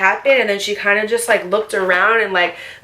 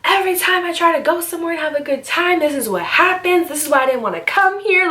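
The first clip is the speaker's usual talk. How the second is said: tearful rant; 7 semitones higher than usual. Retold or imitated. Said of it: "So I'm just like".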